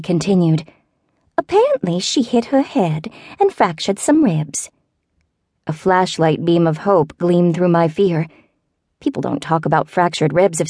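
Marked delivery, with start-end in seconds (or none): 1.4-4.7 put-on elderly southern neighbor. A narrator's reading.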